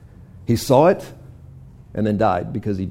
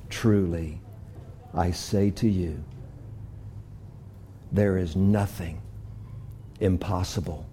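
soft, slow prayer voice; hushed closing prayer; 7 semitones lower, 9 dB quieter than usual. Acted. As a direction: pray quietly to God.

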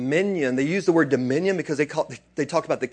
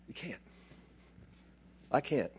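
usual speaking voice

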